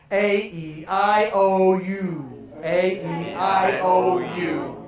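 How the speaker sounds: loud vowel drill A E I O U; exaggerated vowels. Shown as a demonstration. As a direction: open the mouth wide on each vowel. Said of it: "only really exaggerate it".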